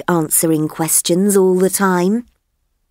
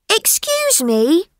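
higher, eager little elephant voice; curious elephant child; 11 semitones higher than usual. Acted. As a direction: ask like a wide-eyed young elephant. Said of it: "said the elephant's child".

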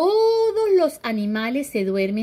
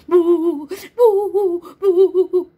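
mock sobbing; 7 semitones higher, 7 dB louder, slower than usual. A child crying in story.

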